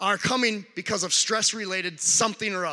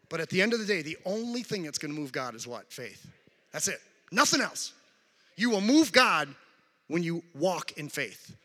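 ordinary talk, nothing marked

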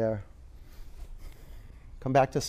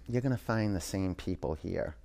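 low and much softer, a dry knowing reply; grim verdict; 4 semitones lower, 8 dB quieter than usual. Acted. Wise man tells the grumpy traveler, low and soft. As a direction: let it drop, quiet and final.

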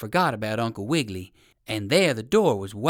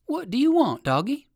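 ordinary delivery

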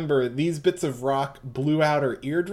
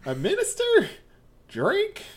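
Southern Belle; 4 dB louder than usual. Acted. GM voicing Mitsuba to Yasuho as a sweet Southern belle. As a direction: play the genteel Southern lady, all honey. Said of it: "doing her Southern Belle".

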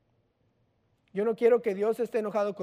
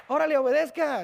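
louder, higher imitated parental order; scolding dad; 5 semitones higher, 4 dB louder than usual. Dad to disobedient child, imitated scolding. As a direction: command like a cross parent. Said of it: "Es como cualquier papá".

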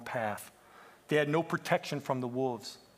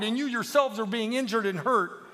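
high, loud outcry; impassioned denunciation; 8 semitones higher, 6 dB louder than usual. Acted. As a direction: cry out against the lies. Peep denounces the lies.

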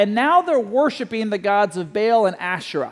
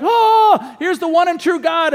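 much higher, louder gasp of wonder; amazed crowd; 7 semitones higher, 4 dB louder than usual. Retold or imitated. The people seeing fire from heaven, amazed exclamation. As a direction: marvel out loud as the crowd. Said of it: "The people are like".

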